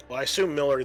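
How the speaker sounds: ordinary talk, nothing marked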